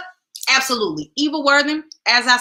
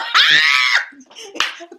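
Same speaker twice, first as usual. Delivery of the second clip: a loud, high burst of laughter; 11 semitones higher, 6 dB louder than usual. Real, not acted. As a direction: laugh out loud after the joke. Laughs after the shouted quote.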